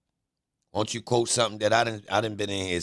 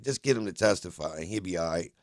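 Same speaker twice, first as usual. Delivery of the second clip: usual speaking voice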